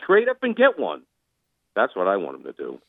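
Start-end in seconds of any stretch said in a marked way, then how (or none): 0.0-1.0 pitched way up, insistent and emphatic; fired-up plea. Real, not acted. Pushing the GM hard to trade up, fired-up high plea. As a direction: hammer home that they need a quarterback. Passionate demand for a quarterback.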